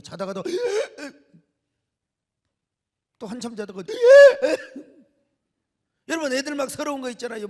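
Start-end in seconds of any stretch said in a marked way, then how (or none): 0.5-1.1 put-on sharp high gasp like a sleeping child; imitating a child's sob gasp; sobbing gasp in sleep. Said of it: "서러워서 억울한 표시를 하는 거예요". 3.9-4.8 put-on sharp, loud, high gasp like a startled child; imitating a startled child's sob; loud sobbing gasp. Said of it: "서러워서 억울한 표시를 하는 거예요".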